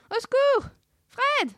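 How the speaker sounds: very high-pitched cartoon wails; panicked calling. Trapped in a chest calling Fred, squealing. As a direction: call your friends in shrieking panic.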